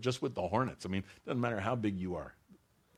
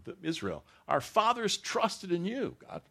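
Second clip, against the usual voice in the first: raised, impassioned reading of the psalm; fervent scripture reading; 5 semitones higher, 6 dB louder than usual. Acted. Preacher proclaiming the psalm to the congregation, impassioned. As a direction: preach the verses out with full force.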